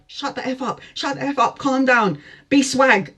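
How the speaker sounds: hiss-free but urgent, high telling-off; hushing best friend. Retold or imitated. Best friend on the phone telling her to calm down, urgent.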